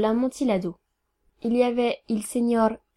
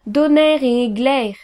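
slow, loud, drawn-out curse; outraged, emphatic swearing; indignant oath; 7 dB louder, slower than usual. Acted. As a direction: thunder each word out slowly. Insulted elector swears back after pause.

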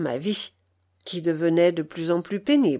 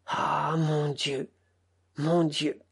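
slow, much softer, sniffling lament; tearful mumble; 5 semitones lower, 6 dB quieter, slower than usual. A narrator's reading. Old cook weeping over Taras killing his son, tearful mumble. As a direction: murmur it through tears, broken. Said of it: "il reniflait et marmottait".

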